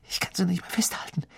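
schnelles, hohes Flüstern; flüstere in Panik; gehetztes Flüstern. A narrator's reading. Ron panics quietly. (Ron flüstert gehetzt zu den anderen)

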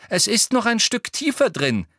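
high, loud call over the shoulder. A narrator's reading. Hermine marching ahead through the wood, calling back loudly. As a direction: call it back to those behind you. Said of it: "rief laut über die Schulter".

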